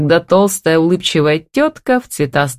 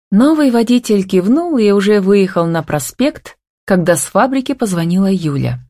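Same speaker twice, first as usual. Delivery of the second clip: ordinary delivery, nothing marked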